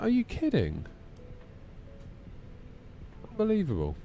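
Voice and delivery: high, disbelieving outburst; are-you-kidding outrage; incredulous. Real, not acted. Outrage at TikTok ban.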